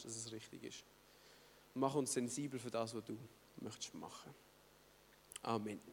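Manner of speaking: soft, low, hushed prayer voice. Acted. Pastor praying quietly for the congregation, soft and low. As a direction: pray quietly and humbly before the talk. Opening prayer before sermon.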